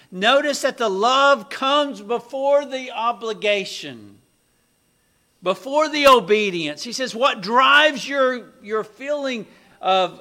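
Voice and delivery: loud, high, full-force sermon delivery; impassioned preaching. Real, not acted. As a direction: proclaim it to the whole congregation.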